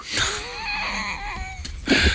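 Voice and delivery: long, high laugh at own joke; high-pitched laugh. Real, not acted. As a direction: laugh out high after the punchline. Caller laughs after own punchline.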